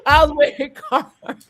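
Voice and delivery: laughing through the words; amused, laughing as she remembers. Real, not acted. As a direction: giggle between the words. Laughing while recalling her outfit.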